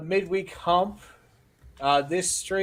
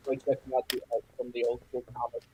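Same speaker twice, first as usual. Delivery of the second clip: muffled, like through a wall; underwater sound; 5 semitones lower, 7 dB quieter, faster than usual. Real, not acted. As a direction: voice smothered by a bad connection. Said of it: "you sounded underwater there for a moment".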